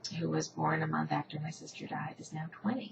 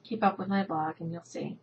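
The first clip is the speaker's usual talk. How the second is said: ordinary talk, nothing marked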